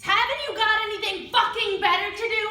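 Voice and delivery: loud, high snapping yell; angry shout. Retold or imitated. Woman yelling at the boy who chased her, angry shout.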